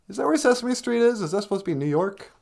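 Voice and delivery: ordinary talk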